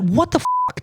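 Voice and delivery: can't believe what you just saw; high, exasperated what the fuck; incredulous. Real, not acted. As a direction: pitch jumps way up in disbelief. Exasperated at a silly video.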